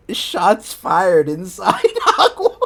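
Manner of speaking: high, slow, collapsing into laughter; breaking into laughter. Real, not acted. Reader reacting to gunfire at Hogwarts, breaking into laughter. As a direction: lose it on the last word. Cracking up at the story.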